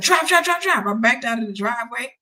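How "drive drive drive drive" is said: high, loud mock panic; imitating a frantic passenger, words repeated urgently; urgent getaway plea.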